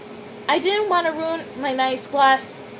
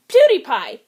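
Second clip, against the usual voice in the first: loud blurt; 3 semitones higher, 7 dB louder than usual. Acted. Blurts cover word loudly.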